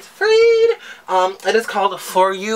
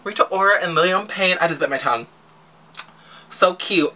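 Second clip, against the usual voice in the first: usual speaking voice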